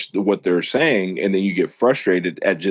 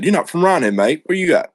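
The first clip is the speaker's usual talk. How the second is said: imitating a UK gent, much higher pitch; friendly British stranger; 10 semitones higher, 3 dB louder than usual. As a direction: playfully mimic a curious Brit. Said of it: "gentleman that walked up to me".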